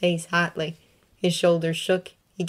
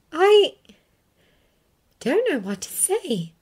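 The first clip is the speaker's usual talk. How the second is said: halting, higher Hermione voice; with some effort; 10 semitones higher, 3 dB louder, slower than usual. A narrator's reading. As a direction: force the words out haltingly. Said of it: "she said with some effort".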